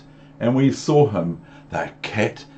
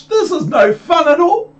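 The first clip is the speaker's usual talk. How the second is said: squeaky fish protest; 8 dB louder than usual. Acted. Fish being held up, squealing high to be put down. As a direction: wail it like a fish dangling in the air.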